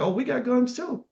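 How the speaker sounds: high, bold defiant voice; quoting defenders with proud defiance; defiant quote. Retold or imitated. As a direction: throw it back like a fighter arming up. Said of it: "They said".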